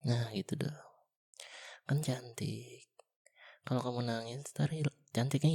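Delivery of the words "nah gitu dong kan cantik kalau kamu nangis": whispered sweet encouragement; tender whisper. Acted. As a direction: coax a smile out of her. Coaxing a smile after tears.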